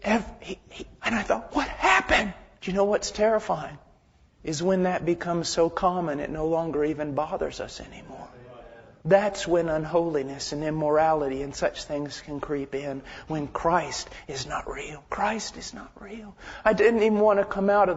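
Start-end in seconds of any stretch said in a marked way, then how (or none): 1.5-2.3 high-pitched bewildered question; a jump up in pitch, taken aback; bewildered reaction. Retold or imitated. Acted startled reaction to own thought. 13.3-16.4 slow, hushed near-whisper; soft, solemn and drawn out; grave hush. Real, not acted. Grave point sinking to near whisper.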